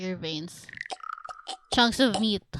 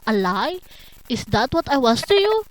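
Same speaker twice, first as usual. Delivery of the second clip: loud, wounded questions; hurt indignation; 4 semitones higher, 7 dB louder than usual. Acted. Betrayed lover asking what they were, hurt indignation. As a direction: fire back each question, rising at the end.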